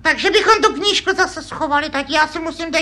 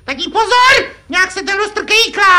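higher, louder cry of warning; alarmed warning; 4 semitones higher, 5 dB louder than usual. Acted. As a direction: warn dad urgently. Syn varuje tátu před padajícím lustrem. (poplašené varování vyšším hlasem)

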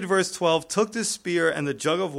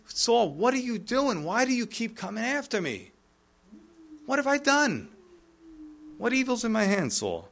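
high-pitched, acted protest; high questioning that drops on the final name; exasperated plea; 7 semitones higher than usual. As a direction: plead in bewildered disbelief. David's plea paraphrased, acted.

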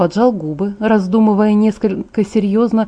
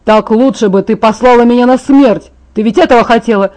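louder bitter outburst; furious accusation; 2 semitones higher, 6 dB louder than usual. Acted. Jacob angrily accuses Bella of wanting him dead. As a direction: accuse her at full voice.

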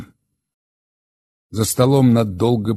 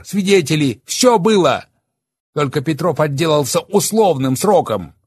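raised, much higher and louder angry voice; Oleg, bitter and enraged; 11 semitones higher, 6 dB louder than usual. Acted. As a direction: hurl the confession at them defiantly. Oleg's bitter outburst.

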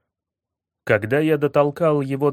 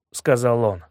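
plain reading voice

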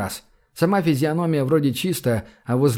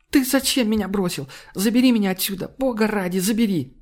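tearful plea; 8 semitones higher than usual. A narrator's reading. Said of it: "громко зашептала она".

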